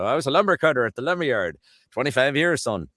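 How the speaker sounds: put-on broad Newfoundland lumberman's accent. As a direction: answer proudly in a Newfie accent. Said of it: "jump the pond over to Newfoundland".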